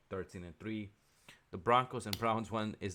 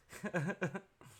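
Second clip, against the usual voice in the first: high, giggly chuckle; real tickled laughter; amused laugh; 11 semitones higher than usual. Real, not acted. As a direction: chuckle at someone's blunder. Amused by Brady's blunder.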